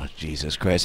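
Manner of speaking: low, quiet imitation of a weary boss; exasperated boss. Boss reacting to the stolen chili news, low quiet exasperation. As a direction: drop down into mock exasperation. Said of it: "And the boss is like".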